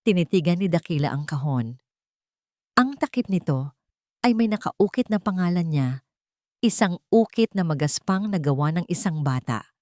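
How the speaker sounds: ordinary delivery, nothing marked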